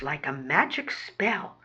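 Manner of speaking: plain reading voice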